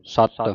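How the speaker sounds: ਸਤ said tightly as "satt"; tight doubled consonant. Shown as a demonstration. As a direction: press hard on the t of ਸਤ. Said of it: "ਇਹ ਕੱਸ ਕੇ ਬੋਲਣਾ ਹੈ".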